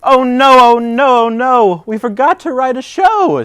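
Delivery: high, loud panicked cry; Oh no over and over, alarmed. Acted. Bear realizing they forgot to write a show, loud panic.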